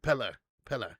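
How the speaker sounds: Pele, Pele said PEL-uh; Pele ending on uh. Shown as a demonstration. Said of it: "More like air at the end".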